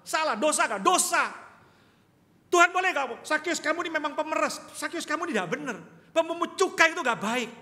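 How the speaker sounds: loud, high rebuke from the pulpit; full-force preaching. Acted. Pastor rebuking hypocrites, loud and high. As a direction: thunder the warning at hypocrites.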